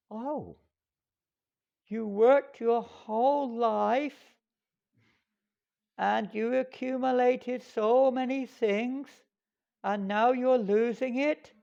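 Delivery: high, very slow, puzzled fool's voice; naive fool. Acted. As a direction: ask it like an innocent simpleton. The fool answers the king.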